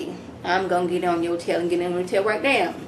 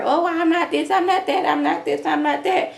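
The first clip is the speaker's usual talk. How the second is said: mock prissy denial; 8 semitones higher than usual. Mocking the girls' denials.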